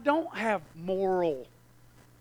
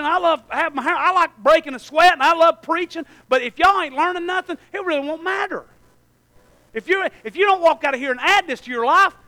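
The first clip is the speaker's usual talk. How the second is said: loud, high preaching at full force; fired-up sermon voice; 5 semitones higher, 7 dB louder than usual. Real, not acted. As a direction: preach it out to the congregation. Preacher builds in intensity.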